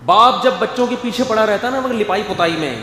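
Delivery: usual speaking voice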